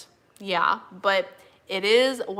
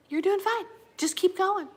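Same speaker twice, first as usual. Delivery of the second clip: higher gentle encouragement; 10 semitones higher than usual. Retold or imitated. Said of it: "she's like".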